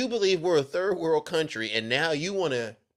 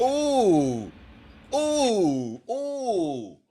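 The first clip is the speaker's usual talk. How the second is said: high, long falling oohs; reacting "ooh"; 5 semitones higher, much slower than usual. Real, not acted. Streamer reacting to a burn, high falling oohs. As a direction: draw out each ooh from up high, sliding down.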